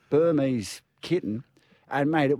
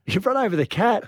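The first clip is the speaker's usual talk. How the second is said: shocked repeat; 7 semitones higher than usual. Retold or imitated. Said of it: "And I'm like".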